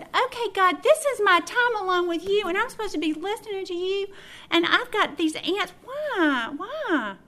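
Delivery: high, loud whiny complaint to God; exasperated plea. Retold or imitated. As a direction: wail it out in mock despair. Complaining to God about ants.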